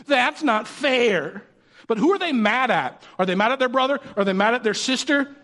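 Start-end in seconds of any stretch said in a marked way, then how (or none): 0.1-1.4 very slow, higher sulky whine; whiny kid. Retold or imitated. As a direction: drag it out like a kid who lost the cookie. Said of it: "That's how they say it too".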